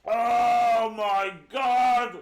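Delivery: very slow, loud groaning shout; drawn-out disgusted shout. Real, not acted. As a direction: stretch it out in revulsion. Reacts to foul taste.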